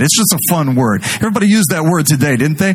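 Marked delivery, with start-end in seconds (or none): none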